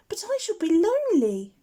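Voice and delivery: put-on a baby owl begging his mother to stay; small owlet voice. A narrator's reading. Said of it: "Plop pleads".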